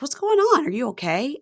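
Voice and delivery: high-pitched worried question; mock alarm. Groom finds his bride crying outside, acted alarm. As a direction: imitate someone startled into concern.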